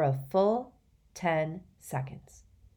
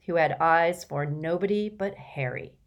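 ordinary delivery, nothing marked

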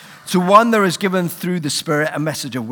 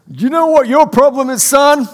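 put-on Kansas accent; 10 semitones higher, 7 dB louder than usual. A pastor imitating the Kansas doctor's accent. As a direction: play the Kansas prophet, big and booming. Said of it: "in a Kansas accent, which I will try to imitate".

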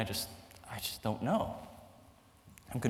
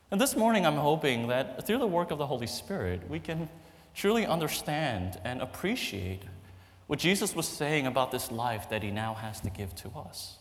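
normal talking voice

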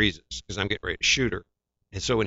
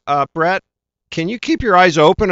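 higher, louder, polite director's ask; director's request; 10 semitones higher, 6 dB louder than usual. Retold or imitated. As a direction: mimic the director's careful request. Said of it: "the director goes".

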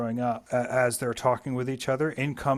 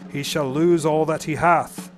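louder triumphant call; a loud, joyful cry; declaimed shout; 4 semitones higher, 7 dB louder than usual. A narrator's reading. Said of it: "he shouted with a great laughter".

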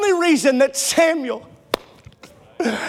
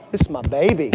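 put-on low, cooing parent clutching a baby; a hint of a doting mom; 11 semitones lower than usual. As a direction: lightly mimic a doting parent.

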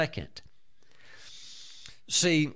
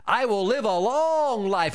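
high-pitched, ringing rebuke; defiant declaration; 6 semitones higher than usual. Shown as a demonstration. Believer calling Satan a liar, defiant declaration. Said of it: "Take that captive and say".

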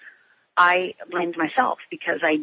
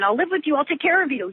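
higher, pleading retold yell; 8 semitones higher than usual. Teen pleading with her armed father, high retold yell. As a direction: cry it up high like a desperate kid. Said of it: "I even yelled at one point".

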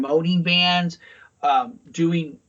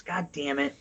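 ordinary talk

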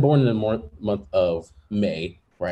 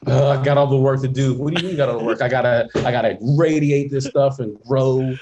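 louder, higher worker bro; busy sun bro; 4 semitones higher, 6 dB louder than usual. Pretend. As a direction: brag about the workload. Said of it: "the sun's like".

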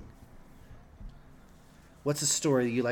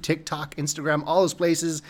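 ordinary talk, nothing marked

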